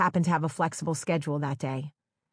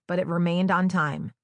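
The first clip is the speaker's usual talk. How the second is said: ordinary delivery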